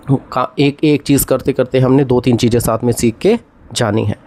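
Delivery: ordinary talk, nothing marked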